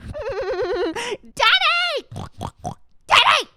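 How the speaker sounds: very high squealed call; villain's daughter. Acted. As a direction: squeal for father like a cartoon character. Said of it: "That's how she laughs".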